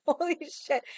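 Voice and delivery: high-pitched retold astonishment; mock amazement. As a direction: imitate a stunned server, high, breaking into laughter. Server's amazed reaction, retold.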